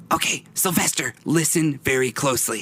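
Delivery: high, put-on conspirator voice; whispery character voice. Skippy confides in Sylvester, high whispery voice. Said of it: "if that was his voice or if he was whispering".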